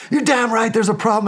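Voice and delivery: indignant retort, pitched high; angry confrontation. Retold or imitated. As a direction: fire back in a mock-angry high voice. Acted quarrel with the boss.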